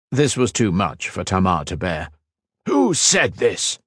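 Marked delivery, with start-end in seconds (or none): 2.7-3.8 higher, slow demand. A narrator's reading. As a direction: demand an answer. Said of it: "he demanded".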